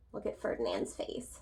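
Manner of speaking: hushed whisper pointing at the page; secretive little whisper. Real, not acted. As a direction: whisper it to draw them in. Pointing at picture.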